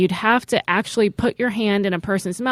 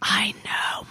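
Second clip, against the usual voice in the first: soft whispered dread; alarmed whisper; 8 dB quieter than usual. Real, not acted. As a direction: whisper it in dismay. Dreading the next stop, Houston.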